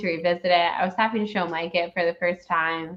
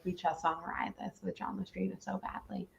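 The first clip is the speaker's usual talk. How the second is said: hushed, breathy whispered wish; whispering; 14 dB quieter than usual. Retold or imitated. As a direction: whisper it like a secret longing. Quoting their own wish, whispered.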